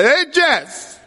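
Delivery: high, fast call up the stairs; mock yell upstairs. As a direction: call up to the son. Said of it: "I yelled upstairs".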